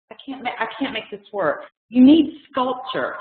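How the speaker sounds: ordinary talk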